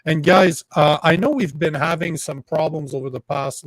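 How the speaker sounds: ordinary talk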